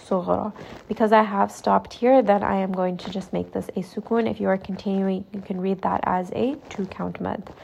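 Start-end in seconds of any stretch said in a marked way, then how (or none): none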